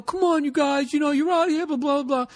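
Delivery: whiny falsetto imitation of a boss; mocking high-pitched manager impression. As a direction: plead in a squeaky high voice. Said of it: "he had this high-pitched voice".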